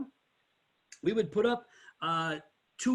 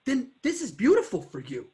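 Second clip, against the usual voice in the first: excited sales pitch; 9 semitones higher, 4 dB louder than usual. Retold or imitated. Salesman enthusiastically pitching the truck.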